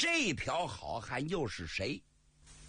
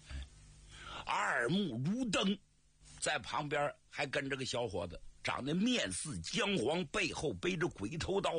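plain reading voice

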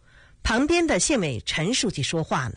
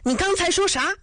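much higher furious scolding; 10 semitones higher than usual. A narrator's reading. Father scolding his son after kicking him. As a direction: berate your rascal son. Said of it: "骂道".

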